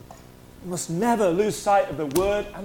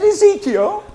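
much higher, louder rising question; surprised echo; 11 semitones higher, 8 dB louder, slower than usual. Retold or imitated. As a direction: echo the name in disbelief. Surprised reaction in retold chat.

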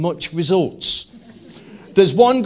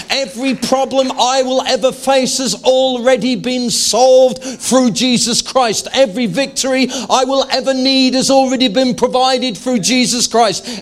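preaching at full force; 7 semitones higher, 4 dB louder than usual. Real, not acted.

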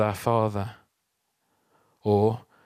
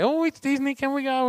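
put-on much higher, eager child voice; imitating excited kids; 14 semitones higher than usual. Father imitating his kids hoping for Disney, much higher and eager. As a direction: play the kids spotting the park sign. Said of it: "We thought I'd see them and say".

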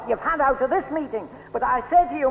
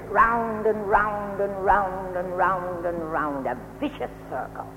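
slow droning circle; 7 semitones lower, 3 dB quieter than usual. Acted. Preacher acting out the endless circle.

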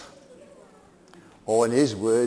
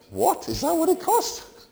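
high, squeaky mock shock at the price; shocked disbelief; 4 dB louder than usual. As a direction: yelp it out like you just saw the bill. Retold shock at wine price.

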